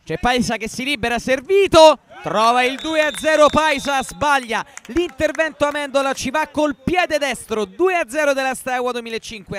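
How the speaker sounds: breathless italian goal commentary. Real, not acted. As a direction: rush to the goal and shout it. Live goal call, second goal.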